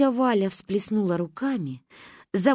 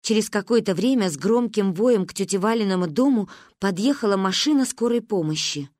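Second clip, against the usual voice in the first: ordinary delivery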